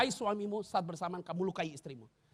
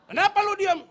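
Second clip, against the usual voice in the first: high, loud half-laughing nag; mock wife's shout; 11 semitones higher, 12 dB louder than usual. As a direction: play the wife snapping at her silent husband. Wife scolding his silence.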